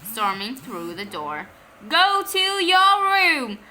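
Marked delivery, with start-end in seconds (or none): 1.9-3.6 high, loud, slow command; angry order. Acted. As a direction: mom sends the boy to his room. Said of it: "shouted Mom".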